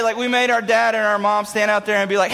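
usual speaking voice

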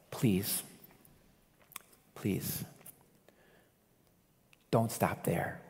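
low, soft pleading; hushed plea. Real, not acted. Preacher begging listeners not to stop there, hushed plea. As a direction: drop right down and implore them, leaving space between pleas.

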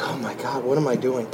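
softly, almost under the breath; a quiet, stunned self-rebuke; hushed dismay. Retold or imitated. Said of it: "And I was like".

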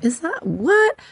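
very high, incredulous squeak of a question; baffled disbelief. Retold or imitated. Open-minded astrologer reacting to alien news, baffled disbelief. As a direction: pitch it right up as if dumbfounded. Acting out her own disbelief.

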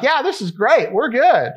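high, upbeat mock assurance; retold breezy confidence. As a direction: brush off the doubt cheerfully. Reassuring worried wife, retold.

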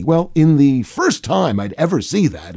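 ordinary talk, nothing marked

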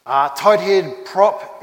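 usual speaking voice

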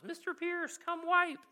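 high-pitched, hollered mock yell; imitated child's yell. Little boy summoning his carer across the park, imitated yell. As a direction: call out like a small boy. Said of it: "top of his lungs".

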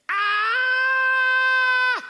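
high frantic shriek of a drowning man; mock drowning scream; panicked scream. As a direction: scream like you're going under.

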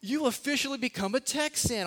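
high, bright announcement of big news; mock excited wife. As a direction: tell him gleefully he's now a Texan. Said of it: "she came up super happy".

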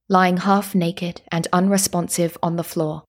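plain reading voice

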